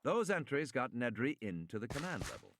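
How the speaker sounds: ordinary delivery, nothing marked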